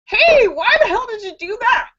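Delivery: high-pitched, hammed-up shock; shocked protest. Shocked Texan to Nevadan who shot the Californian, horrified protest. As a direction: yelp the question in mock horror.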